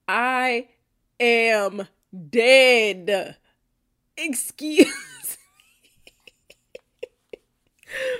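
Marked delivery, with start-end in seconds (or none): 4.2-8.1 breathless laughing whisper; laughing excuse me. Real, not acted. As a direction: whisper it while cracking up. Stunned by confession.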